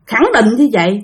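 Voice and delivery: ordinary talk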